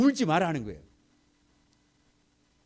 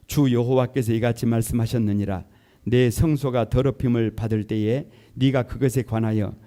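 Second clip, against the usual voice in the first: loud, steady unison reading; loud scripture reading; 4 semitones lower, 7 dB louder than usual. Real, not acted. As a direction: proclaim the Lord's words together. Congregation reads scripture aloud together.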